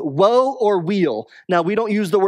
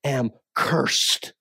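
low, slow, hushed cry of ruin; despairing confession; 5 semitones lower, 5 dB quieter, much slower than usual. Retold or imitated. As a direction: let it sink, broken and quiet. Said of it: "He said".

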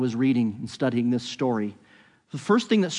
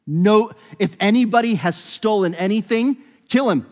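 raised, higher protest of innocence; indignant outcry; 7 semitones higher, 6 dB louder than usual. Acted. Brothers to the steward, loudly protesting their innocence. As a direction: cry it out, sure nobody's guilty.

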